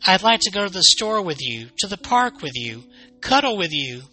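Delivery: plaintive cry. Acted. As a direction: plead like a child starved of attention. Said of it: "Chaki cried".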